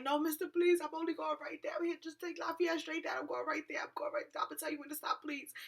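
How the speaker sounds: high-pitched, anxious begging; frantic pleading. Retold or imitated. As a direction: plead with the driver, voice thinning to a whisper. Acts out begging the cab driver.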